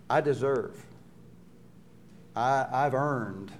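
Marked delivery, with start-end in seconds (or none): none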